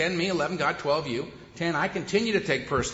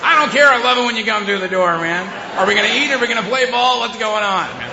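high, loud, breathless joy; excited dog voice; 6 semitones higher, 9 dB louder than usual. Acted. Dog greeting her owner, high and loud with glee. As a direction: gush like a dog that can't contain itself.

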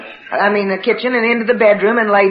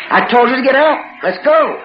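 higher, insistent retold order; impatient mother; 5 semitones higher than usual. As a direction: hurry sleepy children along crossly.

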